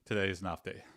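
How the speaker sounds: usual speaking voice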